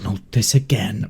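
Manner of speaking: weary whispered sigh of a line; exasperated whisper. Acted. To the player, fed up, a whispered groan. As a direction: breathe it out, fed up. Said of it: "she's actually gonna be a little bit exasperated".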